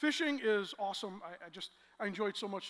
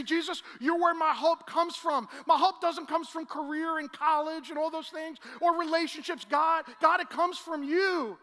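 loud, higher impassioned prayer; fervent prayer; 5 semitones higher, 9 dB louder than usual. Shown as a demonstration. Preacher showing the congregation how to pray, loud and fervent. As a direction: pour your heart out to Jesus.

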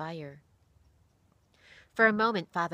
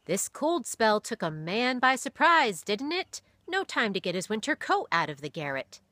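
louder, higher, friendly pastor voice; kindly pastor; 5 semitones higher, 7 dB louder than usual. A narrator's reading. Pastor tactfully mentioning the boy's thin coat, friendly. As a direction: tease gently about the cold.

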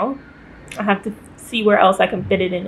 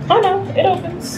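surprised; 7 semitones higher than usual. Real, not acted. Surprised window opens.

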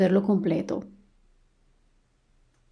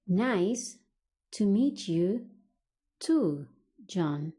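slow teaching delivery with pauses; broken into chunks; much slower than usual. Shown as a demonstration. English teacher models replying to John, broken into chunks. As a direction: separate the words for learners to repeat.

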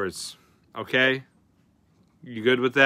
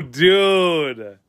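usual speaking voice

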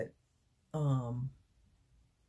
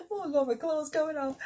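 squealing with joy, much higher; high squealing laugh; 13 semitones higher, 4 dB louder, faster than usual. Real, not acted. Overjoyed about her new camera, squealing with laughter.

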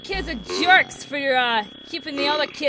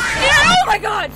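high, loud drawn-out yell; startled scream; 9 semitones higher, 6 dB louder, much slower than usual. Real, not acted. Player attacked by Foxy, startled scream. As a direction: shriek as something leaps at you.

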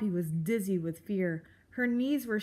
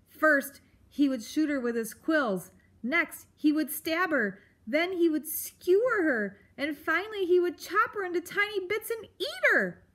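building louder and higher; rising dread; 7 semitones higher, 4 dB louder than usual. A narrator's reading. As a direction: pile on the horrors faster. Narrator builds Poppy's panic.

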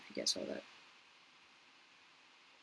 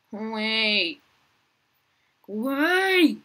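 building yell; 3 semitones higher, 8 dB louder, slower than usual. Real, not acted. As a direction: go away then a higher, much louder Go away!. Shooing a player, rising to yell.

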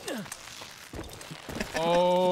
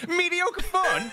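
mock outrage; 11 semitones higher, much slower than usual.

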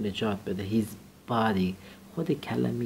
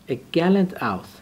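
slow reading; 5 semitones higher, 5 dB louder, much slower than usual. Shown as a demonstration. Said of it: "teacher reads phrase slowly".

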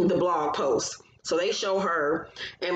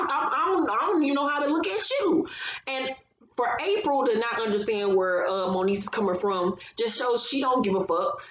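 normal talking voice

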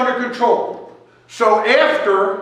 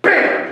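punchy bam; 9 semitones higher than usual. Retold or imitated. Describing Barry acting fast.

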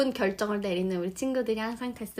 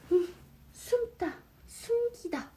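hushed hiding voice; 8 semitones higher, 7 dB quieter, slower than usual. Acted.

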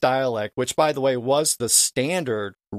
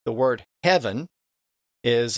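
normal talking voice

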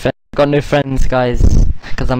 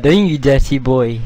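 ordinary talk

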